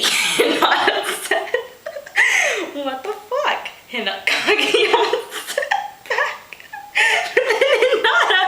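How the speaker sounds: high, giggling reading voice; losing it while trying to read on; can't keep a straight face; laughing through the words. Real, not acted. Reader breaks into laughter reading.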